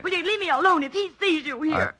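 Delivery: high, urgent begging; frightened pleading. Acted. Scared woman begs the detective to leave her alone.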